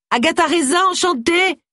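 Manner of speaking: high-pitched cry of introduction; high, called-out greeting; calling out. A narrator's reading. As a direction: call it out brightly after someone leaving. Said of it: "cria Agatha".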